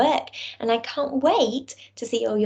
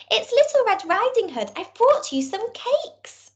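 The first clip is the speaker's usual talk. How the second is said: Little Red's voice; 11 semitones higher than usual. Acted. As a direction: speak up high like a small girl. Little Red answers at door.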